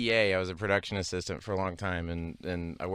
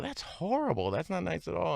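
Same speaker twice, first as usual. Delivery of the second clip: pitched-up mock reproach; higher, lightly acted disapproval; imitating disapproving listeners; 9 semitones higher than usual. Voicing disapproving listeners of story.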